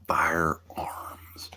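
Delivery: a hushed afterthought; whispering. Real, not acted. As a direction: whisper the word to yourself. Whispered echo.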